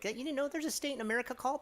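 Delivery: high, fast, mock-amazed voice; mock incredulous local. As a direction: play the smug local who can't believe you don't know. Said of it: "you two will just be like".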